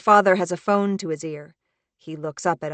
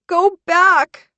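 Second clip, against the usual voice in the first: high, loud weeping plea; 12 semitones higher, 10 dB louder than usual. Acted. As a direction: beg through tears, high and loud. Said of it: "Harper weeps".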